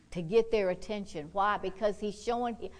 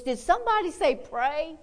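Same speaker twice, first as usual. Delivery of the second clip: a hint of an eager little grandma, pitched up; 8 semitones higher, 7 dB louder than usual. Little grandma popping round the aisle, eager. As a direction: chirp it like a keen old lady. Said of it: "She goes".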